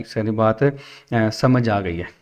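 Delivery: ordinary talk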